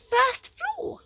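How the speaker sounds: a fluttery, high little man; Flabbergast voice. A narrator's reading.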